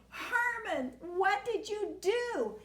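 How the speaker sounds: put-on high, very slow shocked old man; old man aghast at the giant worm; alarmed old man. A narrator's reading. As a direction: stretch it out in disbelief.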